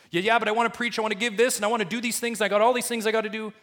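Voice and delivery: high, loud, rushed eager busy believer; impatient objection. Retold or imitated. Preacher voicing a church member who wants to preach, high and rushed. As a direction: brush it off like a busy go-getter. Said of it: "voicing busy believer's objection".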